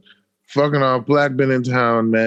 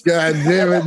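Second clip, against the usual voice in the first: ordinary delivery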